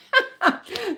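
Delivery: real burst of high laughter; amused laugh. Real, not acted. Amused by listener's comment.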